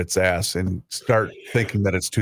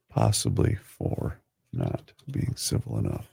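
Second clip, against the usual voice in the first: whispering to self; 12 semitones lower, 6 dB quieter than usual. Real, not acted. Typing while repeating dictation.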